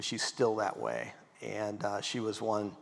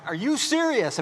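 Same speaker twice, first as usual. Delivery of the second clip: much higher, louder incredulous question; stunned disbelief; 12 semitones higher, 4 dB louder than usual. Retold or imitated. New homeowner to father-in-law, stunned, high pitch. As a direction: shoot up in pitch, floored.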